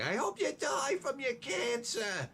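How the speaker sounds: high, slow shrieked curse; hammed-up screaming neighbor woman; mock scream. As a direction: screech it like the furious wife next door. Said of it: "Screaming it".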